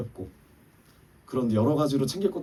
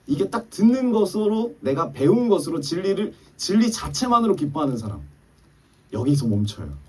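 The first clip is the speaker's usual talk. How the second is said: preaching exhortation; 10 semitones higher, 4 dB louder than usual. Acted. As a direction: lift the congregation, press each phrase.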